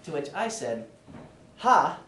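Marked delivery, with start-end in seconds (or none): 1.6-2.0 sharp, loud scoffing "Ha", pitched up; a short loud scoff of disbelief. Retold or imitated. To himself at the passed-out roommate, a loud scoffing ha.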